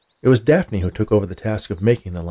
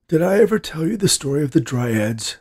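put-on higher-pitched old grandpa voice; ailing grandpa; 6 semitones higher than usual. A narrator's reading. Sick grandpa asks his granddaughter about dryads, old man voice. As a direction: ask as the old storyteller, pitched up.

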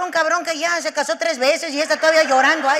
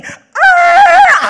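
very high, loud screech of a near crash; imitated sound effect; 13 dB louder than usual. Man acting out his car crash for a friend, imitated sound effect. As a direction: screech out the swerve as a noise. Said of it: "Hasta te hace efectos de sonido".

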